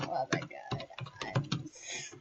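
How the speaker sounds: breathless whisper; whispered, overwhelmed. Real, not acted. Vlogger whispering as she is overwhelmed to tears. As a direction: whisper it, choked up. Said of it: "I'm crying".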